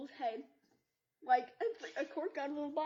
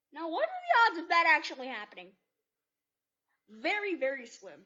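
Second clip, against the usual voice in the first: loud joking aside; 4 semitones higher, 12 dB louder than usual. Real, not acted. Joking aside about unlikely story.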